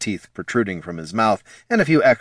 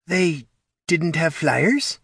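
slow, high, unsure spectral voice; doubtful ghost lady; 8 semitones higher, slower than usual. Acted. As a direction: offer it hesitantly. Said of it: "Genevieve sounded doubtful".

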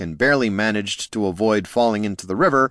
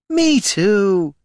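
high, louder, gushing delight; squealing excitement, pitched way up; 12 semitones higher, 5 dB louder than usual. A narrator's reading. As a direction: gush it like a thrilled fan. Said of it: "gushed the elf".

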